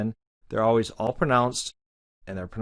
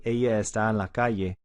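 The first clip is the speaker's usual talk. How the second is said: Latin American Spanish, calle said kaye; Ella with a y sound, Latin American style; Latin American accent. Shown as a demonstration. Teacher contrasts Latin American with Argentine accent. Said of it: "typical Latin American way".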